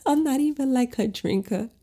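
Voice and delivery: high laughing confession; laughing aside. Real, not acted. Telling viewers she drank wine, laughing high. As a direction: laugh through it, a bit sheepish.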